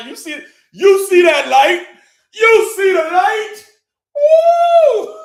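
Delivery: high, slow, loud shouting; excited shout. Real, not acted. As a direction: preach it out like a revival. Hyped preaching to livestream.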